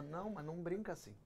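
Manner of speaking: imitated solemn reproach, slowing at the end; offended wife. Wife scolding husband for joking, quiet stern reproach.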